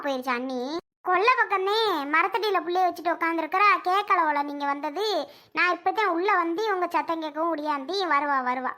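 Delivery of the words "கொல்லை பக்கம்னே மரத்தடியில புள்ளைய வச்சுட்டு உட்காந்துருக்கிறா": ordinary talk, nothing marked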